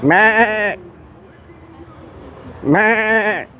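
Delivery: high nasal-free bleating meh; goat bleat. Retold or imitated. Teasing the goats with a bleat.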